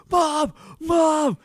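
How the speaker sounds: very high, loud mock shout; calling out. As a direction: yell for mom in a hammed-up panic. Brother calling out for mom.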